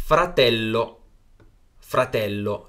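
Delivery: fratello said slowly with a long double l; teacher models fratello slowly for learners; model pronunciation. Shown as a demonstration. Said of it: "下一个是fratello".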